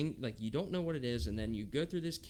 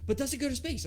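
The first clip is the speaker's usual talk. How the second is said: put-on worried little boy; 14 semitones higher, 4 dB louder, faster than usual. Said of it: "He's like".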